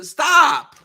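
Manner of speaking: ordinary delivery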